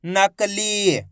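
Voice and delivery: नकली read out with a long ई; drawn-out long ई. Shown as a demonstration. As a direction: stretch the final ली of नकली. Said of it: "खींच के पढ़ा जाता है".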